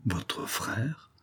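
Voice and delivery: breathless whisper of disbelief; stunned, whispered echo; shocked whisper. Acted. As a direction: barely voice the question, caught off guard. Lover stunned by the news.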